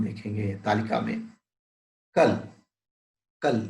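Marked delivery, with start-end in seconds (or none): 2.1-2.3 "कल" said crisply, with a short a; short vowel demo. Shown as a demonstration. Teacher to class, showing the short vowel: "कल" kept brief. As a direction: clip "कल" short, no stretched vowel. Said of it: "केवल इसे पढ़ना चाहिए".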